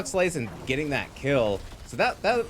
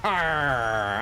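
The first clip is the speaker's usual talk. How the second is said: pirate voice; 3 semitones lower than usual. Pretend. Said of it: "like it's Talk Like a Pirate Day".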